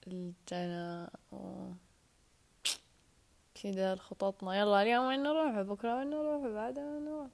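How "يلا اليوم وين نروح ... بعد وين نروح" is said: rising, lively replay of daily plans; eager planning. Retold or imitated. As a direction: relive the excitement of each day. Recalling daily trip plans.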